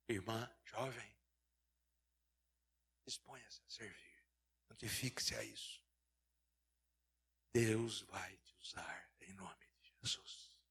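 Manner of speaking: slow, hushed whisper of assurance; whispered blessing. Real, not acted. Preacher blessing the congregation, slow intimate whisper. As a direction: lean in and whisper the promise.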